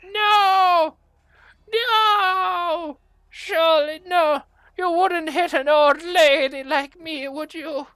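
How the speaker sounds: put-on high, loud wailing old-lady plea; pleading kobold granny. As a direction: beg for mercy in a cracking granny squeal. Kobold begs not to be hit.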